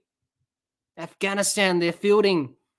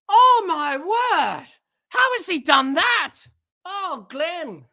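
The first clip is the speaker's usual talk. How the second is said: slow, higher, stretched-out amazement; awestruck; 6 semitones higher, slower than usual. Real, not acted. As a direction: let the wonder pour out. After the huge six.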